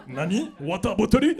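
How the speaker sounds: loud, rising mock Japanese surprise; hammed-up Japanese-accented disbelief; startled anime character. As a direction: exclaim it like a shocked manga hero. Acts startled anime-style reaction in Japanese.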